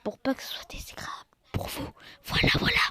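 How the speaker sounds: fast soft whisper; ASMR whisper. Real, not acted. Trying not to shout at viewers, an ASMR whisper. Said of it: "je fais de l'A S M R".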